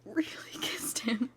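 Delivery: hushed confession; whisper. Acted. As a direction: whisper it ashamed.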